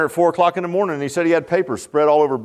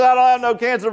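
joyful repeat; 7 semitones higher than usual. Retold or imitated. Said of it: "He said".

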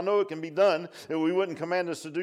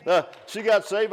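usual speaking voice

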